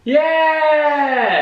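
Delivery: very high, loud drawn-out cheer; a squealed, stretched hurrah; playful cheer. Real, not acted. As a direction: whoop it out, long and high. Cheers the photographic model skill.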